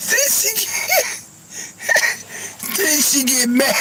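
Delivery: high squeals of laughter through the words; laughing hard. Real, not acted. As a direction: squeal it out while cracking up. Laughing while retelling.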